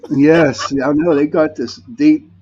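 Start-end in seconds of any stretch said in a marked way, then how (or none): none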